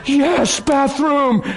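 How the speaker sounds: high, excited cry of delight; elated relief. Retold or imitated. Desperate traveling buddy spots the bathroom, elated relief. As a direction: exclaim it high, like a desperate man spotting rescue. Acting out friend spotting a bathroom.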